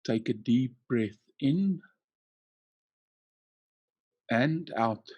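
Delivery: ordinary talk, nothing marked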